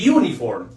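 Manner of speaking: "uniform" begun on a vowel, "ee-oo-niform"; wrong ee-oo onset. Shown as a demonstration. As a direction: add an ee before "uniform". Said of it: "no se dice uniform".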